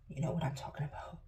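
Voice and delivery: fast whispered aside; grieving mother whispering about dark thoughts. Real, not acted. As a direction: hush it to the viewers.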